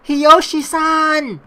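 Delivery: loud, high, slow cry of his name; desperate shout. Acted. Mayu shouting to wake the unconscious Hiyoshi, desperate.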